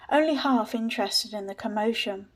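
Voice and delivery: ordinary delivery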